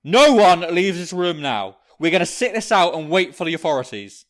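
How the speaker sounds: stern bellowed command; commanding shout. Acted. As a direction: lay down the law, loudly. Father laying down the law.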